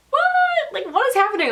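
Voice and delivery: very high, incredulous "what"; exaggerated baffled squeal easing down; hammed-up disbelief; mock bewilderment. Retold bewildered reaction to staff.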